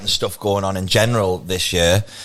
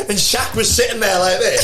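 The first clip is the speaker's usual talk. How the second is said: laughing; 9 semitones higher than usual. Real, not acted. Laughing recount.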